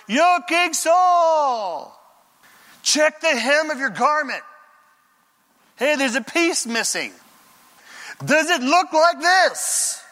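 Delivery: David calling out to Saul from a distance, loud and drawn out; calling from afar. Retold or imitated. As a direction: shout it to the king far away. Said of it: "David, a goodly distance away".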